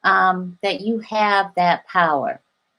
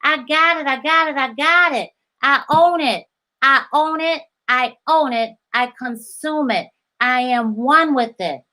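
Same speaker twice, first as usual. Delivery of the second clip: slightly higher, savoring each claim; manifesting affirmations; 4 semitones higher than usual. Shown as a demonstration. Coach voicing affirmations to viewers, slightly higher and savoring. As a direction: chant it as if already yours.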